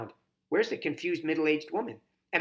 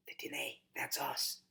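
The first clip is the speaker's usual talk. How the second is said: much softer aside to her brother; whispered aside; 9 dB quieter than usual. A narrator's reading. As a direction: murmur it under the storyteller's voice. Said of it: "I whisper to Mac".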